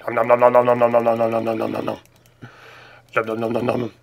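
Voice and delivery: fast silly nom nom; nom nom chomping. Pretend. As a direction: chomp out noms quickly. Chomping sounds while signing off.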